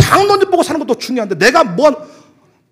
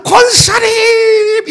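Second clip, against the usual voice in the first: loud, high, drawn-out greeting call; joyful call; 10 semitones higher, 5 dB louder, much slower than usual. Retold or imitated. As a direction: call out to the deaconess across the lot, thrilled. Calling out to deaconess.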